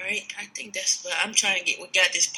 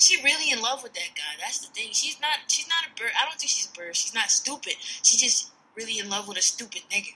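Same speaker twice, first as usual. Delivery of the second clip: normal talking voice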